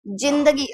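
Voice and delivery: जिंदगी with a hard j, jindagi; plain j. Shown as a demonstration. Teacher reading it as written in Hindi, जिंदगी with plain j. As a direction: begin जिंदगी with j as in jam. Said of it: "अगर मैं इसका उच्चारण करूँ".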